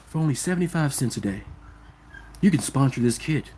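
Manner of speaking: mock a gloomy charity commercial announcer, low and quiet; sad charity ad voice. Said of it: "He was so sad".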